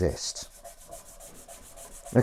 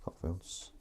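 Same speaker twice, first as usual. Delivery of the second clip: whisper; 4 semitones lower, 20 dB quieter than usual. Real, not acted. Whispered name joke.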